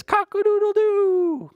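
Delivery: high, loud rooster call; a full cock-a-doodle-doo, high and loud; rooster crow. Acted. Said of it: "there's that rooster that says".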